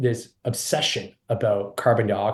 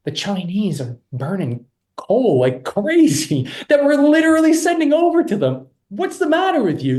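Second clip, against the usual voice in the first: exasperated; 11 semitones higher, 6 dB louder than usual. Real, not acted. Exasperated rant about China coal.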